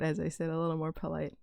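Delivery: usual speaking voice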